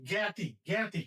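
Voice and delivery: জ্ঞাতি said as "geti", clearly; জ্ঞাতি: "geti" diye düzgünce söyle; correct pronunciation. Shown as a demonstration. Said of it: "আমরা বলি কি?".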